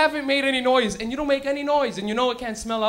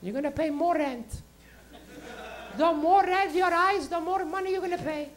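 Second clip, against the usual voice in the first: high, insistent mother voice; imitated mom; 7 semitones higher than usual. Mom charging her high son rent, high-voiced. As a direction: spell out the deal as a pitched-up mom.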